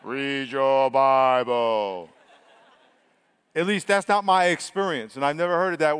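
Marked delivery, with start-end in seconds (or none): 0.0-2.1 booming, drawn-out deep voice; low, very slow mock voice of God; James Earl Jones. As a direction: stretch every word long and low. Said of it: "You're not gonna hear James Earl Jones saying".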